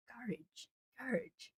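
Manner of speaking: soft "Courage" for garage, as GARR-ij; imitated Northern Irish pronunciation. Streamer recalling a Northern Irish presenter, soft imitated "Courage" as GARR-ij. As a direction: try out "Courage" very softly, stress first, -ij ending. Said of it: "he says it like that".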